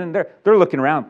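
ordinary talk

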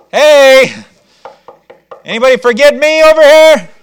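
loud high call through the door. Acted. Peter left outside, calling loudly to be let in. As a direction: make yourself heard outside.

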